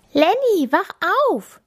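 high, squeaky little animal voice; squeaky meerkat. A narrator's reading. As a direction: squeak it out like a tiny meerkat knocking on glass. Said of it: "quietscht Tami".

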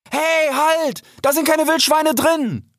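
high, urgent protest; urgent high-pitched call. A narrator's reading. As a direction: cry out to stop an attacker. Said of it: "rief Rix".

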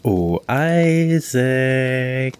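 very slow, high, eerie call; creepy scratchy voice. Acted. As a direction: draw it out like a creeping clown. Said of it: "eine gruselige, kratzige Stimme".

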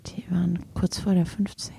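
soft conspiratorial whisper. Real, not acted. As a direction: whisper back the secret. Whispered answer to whispered question.

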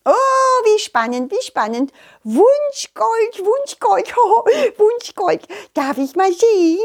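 high butterfly voice, bubbling with curiosity; excitable butterfly. Acted. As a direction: squeal it high, thrilled to peek.

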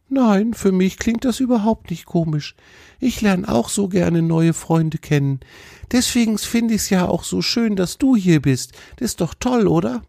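high-pitched, glad deer voice; friendly deer, encouraging. Acted. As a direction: reassure a new friend in a high animal-character voice.

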